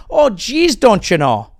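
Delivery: high folksy Minnesota "oh geez"; hammed-up Upper Midwest voice; Minnesota accent. Comic mock Minnesotan repeating catchphrase.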